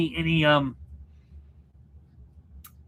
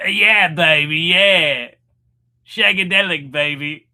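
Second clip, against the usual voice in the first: loud, hammed-up mock British swinger; Austin Powers; 10 dB louder, slower than usual. Host teasing a British viewer, loud bad movie impression. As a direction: belt it as a bad spy-spoof impression. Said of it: "'Cause he's like".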